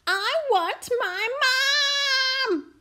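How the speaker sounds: very high, loud, stretched shriek. A narrator's reading. Princess left with little prince, shrieking for mum. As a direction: shriek it as a small child. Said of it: "shrieked the little princess".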